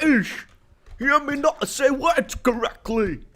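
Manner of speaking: high goofy British voice; hammed-up cartoon Brit; Goofy British accent. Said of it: "I'm British".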